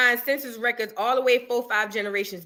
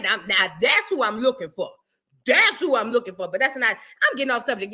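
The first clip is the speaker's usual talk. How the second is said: normal talking voice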